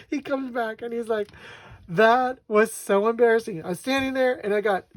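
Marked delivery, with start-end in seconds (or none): 1.9-4.9 loud, raised, worked-up mock exasperation; retelling embarrassed rant. Husband telling wife about the drugstore, embarrassed rant. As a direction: pour it out like an embarrassed husband venting. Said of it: "getting more and more embarrassed".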